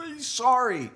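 high mock sob, then a whimpered word; mock sobbing. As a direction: pretend to bawl like a broken man. Mock sobbing after crying.

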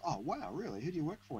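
high, impressed question; mock impressed. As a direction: play it wide-eyed and impressed. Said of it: "he goes".

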